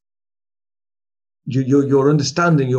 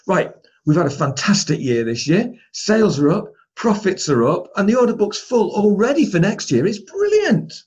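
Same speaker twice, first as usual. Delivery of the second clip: upbeat, rising higher and higher to a thrilled peak; enthusiastic; 6 semitones higher than usual. Shown as a demonstration. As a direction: sell the great news to the team. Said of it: "same words, but do you get a different message".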